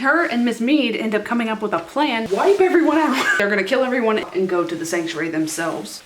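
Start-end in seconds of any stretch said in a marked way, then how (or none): 2.3-3.2 high, loud mock battle cry; hammed-up shout. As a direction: yell the evil plan with gusto. Excitedly announces the villains' plan.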